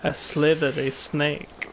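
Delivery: slow hissing snake voice; drawn-out s like a snake. Acted. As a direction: stretch every s like a slithering snake. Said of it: "I was trying to sound like a snake".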